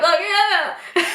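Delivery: high, teary laugh on oh yeah; laughing through tears. Real, not acted. Wife overwhelmed while telling husband, laughing through tears. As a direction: laugh and cry at once. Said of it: "so emotional".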